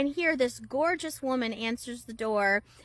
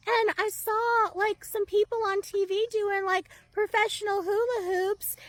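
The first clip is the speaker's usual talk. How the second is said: put-on much higher teary little-girl whine; childlike voice; 9 semitones higher than usual. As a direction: excuse yourself like a crying child. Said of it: "this childlike voice".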